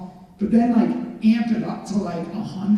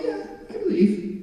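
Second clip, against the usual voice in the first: very high, wishy-washy mimicry; mock lukewarm believer in a high voice; half-hearted agreement; 13 semitones higher than usual. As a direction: imitate an unconvinced yes, pitched way up.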